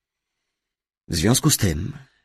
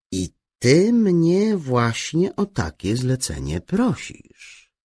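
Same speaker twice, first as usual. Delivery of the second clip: drawn-out, higher-pitched disbelief; slow; 8 semitones higher, 3 dB louder, slower than usual. A narrator's reading. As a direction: say it slowly, weighing each word. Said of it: "powiedział powoli". (powoli, z wyższym tonem)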